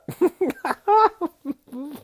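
high cackling laugh at the joke; burst of laughter. Real, not acted. Laughs at tweet he read.

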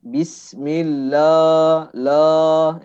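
bismillah, la stretched slowly and loud; slow drawn-out bismillah. Shown as a demonstration. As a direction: draw out bismillah, la syllable by syllable. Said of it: "Harusnya kita tipiskan bismillahi".

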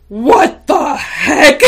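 high, loud, slow laughing outburst; startled outrage. Retold or imitated. Shocked by the coworker's strange prediction, loud. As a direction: burst out in disbelief. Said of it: "I'm like".

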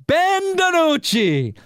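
high, slow, stretched-out name call; drawn-out mock fanfare. Host hypes the obscure backup quarterback's name, high and drawn out. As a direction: announce him like a big star, as a joke.

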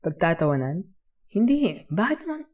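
high, loud, taken aback; incredulous questions jumping high; surprised denial. Acted. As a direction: protest the very idea, pitch leaping up. Startled denial to worried child.

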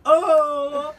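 high, loud surprised gasp then laugh. Real, not acted. Reacting to the glass reveal with a loud gasp and laugh.

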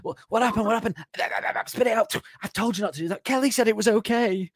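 high, frantic parent voice; panicked parent. Acted. Parent panicking at a child choking on gum, high and frantic. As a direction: shriek like a mum whose kid just choked.

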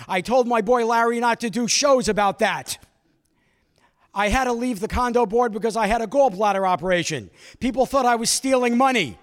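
put-on loud, high, upset old man ranting; old man shouting about being shunned; old man yelling. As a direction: rant like an outraged old neighbor, voice up and loud. And he's yelling at me.

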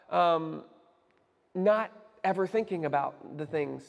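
ordinary talk

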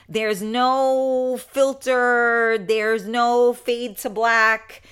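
slow, drawn-out stress on no; emphatic. Real, not acted. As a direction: lean hard on every no. Insists live show unedited.

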